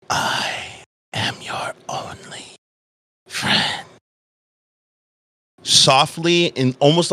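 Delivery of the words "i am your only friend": slow, eerie whisper in the ear; soft whisper. Acted. A voice speaking into the listener's ear, whispering. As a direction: whisper it softly, drawing out each word. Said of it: "like, whispering on your ear".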